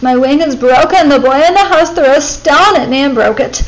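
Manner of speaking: a small bird faking an injury, loud; bird calling out. A narrator's reading. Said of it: "she called out".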